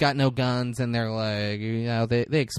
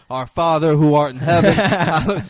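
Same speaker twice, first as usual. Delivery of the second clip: loud, high mock praying; panicked prayer; 5 semitones higher, 10 dB louder than usual. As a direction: pray loudly for your life, hammed up. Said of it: "starts saying the Our Father immediately".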